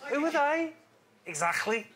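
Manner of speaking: high, slow Lancashire ad voice; quoting the advert in a hammed-up Lancashire accent; attempted Lancashire accent. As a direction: have a go at a northern accent from an old advert. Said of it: "I'm gonna attempt the accent".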